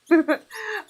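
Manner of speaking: high loud laugh; sudden bright cackle; burst of laughter. Real, not acted. Laughing at her own story.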